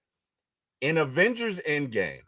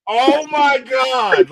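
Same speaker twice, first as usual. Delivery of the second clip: crowd erupting; 11 semitones higher, 8 dB louder, much slower than usual. Retold or imitated. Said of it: "the crowd erupted like".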